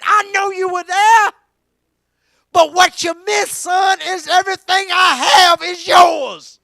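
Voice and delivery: loud, shouted gospel preaching; preaching at full force. Acted. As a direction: call the whole church in, at full voice. Father's words, preached loud.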